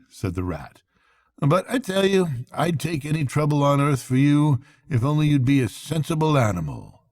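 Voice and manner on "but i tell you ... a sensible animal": lightly raised, brisk and hearty friend; Rat's brisk cheery voice. A narrator's reading. Rat rousing Toad from bed, hearty and brisk. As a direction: coax a sulking friend out of bed.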